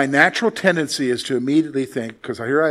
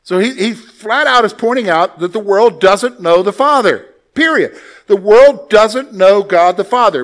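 emphatic preaching; 4 semitones higher, 7 dB louder than usual. Real, not acted.

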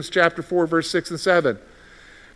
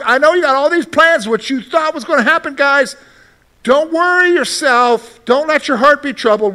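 reassuring Jesus; 7 semitones higher than usual. Retold or imitated. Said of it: "Jesus is coming along".

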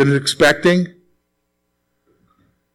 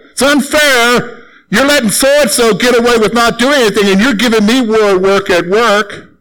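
higher, mock-whining grown-up; whiny complaint; 6 semitones higher than usual. Worker griping to the boss, childish whine. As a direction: whine like a child about work.

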